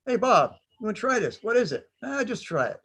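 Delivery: a hint of sneaky soldiers coaxing a friend to try it; playful buddy voices. Buddies offering a swig of whiskey.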